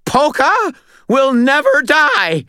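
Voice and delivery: high, loud, slow shriek; drawn-out, high-pitched frantic yell. A narrator's reading. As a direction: shriek it with all your might. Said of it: "he shrieked".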